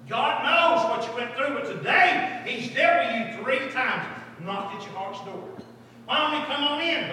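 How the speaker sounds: loud, high, measured revival appeal; urgent preaching. Real, not acted. Preacher inviting the girl in, urgent. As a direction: press the invitation home with force.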